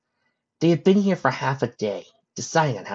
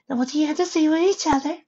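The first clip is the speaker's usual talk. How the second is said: scolding Fluttershy. Acted. Fluttershy scowling at her guilty friends, high scolding voice. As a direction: demand an apology in a high voice.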